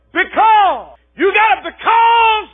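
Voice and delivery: high, loud, slow preacher's shout; preaching refrain. Acted. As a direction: hurl the question at the congregation.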